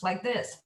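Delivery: wild high, loud cackle; crazy laugh. Shown as a demonstration. Voice coach demonstrating a crazy laugh. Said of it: "try laughing like a crazy person".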